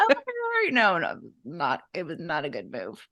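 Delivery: ditzy California blonde realizing her mistake, lightly voiced. Recalling her blunder at Yankee Stadium as a California blonde, light. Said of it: "I went full California blonde mode".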